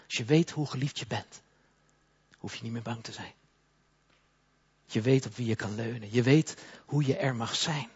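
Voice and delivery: usual speaking voice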